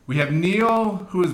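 slow game show host build-up; announcer voice. Acted. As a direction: stretch out the contestant intro. Host introducing blindfolded contestant.

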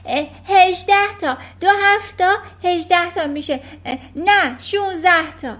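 put-on high, loud little boy blurting in panic; panicked shout. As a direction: yelp the answers like a scared kid. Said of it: "داد زد".

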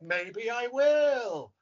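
very slow, high sing-song swoop; Norman Brenner. Retold or imitated. Actor mocking co-star's odd delivery, stretched sing-song. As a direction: exaggerate the odd lilt, stretching every word. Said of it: "no one talks like that".

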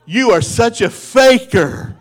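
laughing mock accusation; mock exasperation. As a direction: call the kid out in playful disbelief. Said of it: "I was like".

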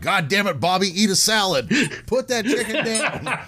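high mock scolding, slowing at the end; imitating Paul Orndorff. Paul Orndorff scolding Bobby at the buffet, high mock voice. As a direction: nag him off the buffet in a high put-on voice.

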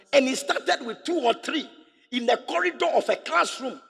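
rising, shouting preacher build; preaching at full force. Real, not acted.

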